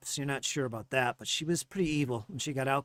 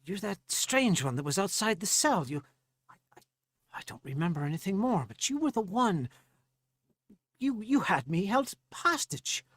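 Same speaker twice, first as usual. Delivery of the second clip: higher, halting accusation; confronting Farley Tell; 8 semitones higher than usual. Acted. Farley recognizing the gnome who held him, higher and halting. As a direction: accuse your captor, pitch climbing. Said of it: "I said".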